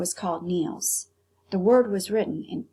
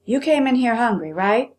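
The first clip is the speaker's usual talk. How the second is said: louder, pushing question; stern cook; 5 semitones higher, 7 dB louder than usual. Acted. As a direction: lean on the boy hard. Angry cook confronts boy.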